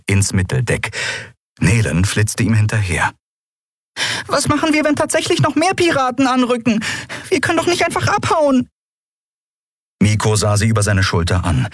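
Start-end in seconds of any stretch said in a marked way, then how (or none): 4.3-8.7 very high, tiny voice, worried; anxious little winged companion. Acted. Small companion fretting to the boy about pirates, anxious high voice. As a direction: squeak out the worry.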